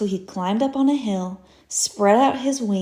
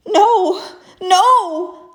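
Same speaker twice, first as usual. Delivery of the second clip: high, loud, pleading refusal; desperate no; 10 semitones higher, 9 dB louder than usual. Acted. Stuck rabbit answering Mister Groundhog, high loud pleading no. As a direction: beg with all his heart. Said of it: "said the little rabbit".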